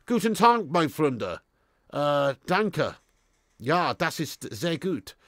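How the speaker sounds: hammed-up German, last line slow; mock German greeting. Greeting a German viewer in hammed-up German. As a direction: play a jolly German host.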